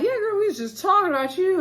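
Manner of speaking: high put-on sweetness, imitating the girls; a high, sugary fake welcome; mock fake-friendly greeting. Women greet Winter, fake friendly.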